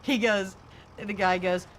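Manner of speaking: ordinary delivery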